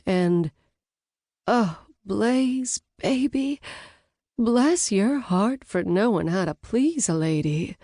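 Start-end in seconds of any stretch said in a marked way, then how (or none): 1.5-7.8 put-on breathy Southern belle accent, higher on Blaze. Brunette thanking Blaze in a Southern belle accent. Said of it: "a slight Gisabama accent".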